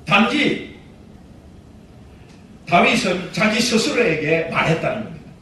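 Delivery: normal talking voice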